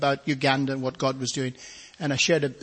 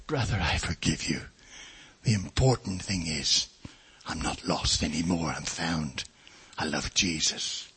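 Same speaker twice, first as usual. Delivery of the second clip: gentle forgiveness; 9 semitones lower, 4 dB quieter than usual. Retold or imitated. Preacher forgiving the weeping man.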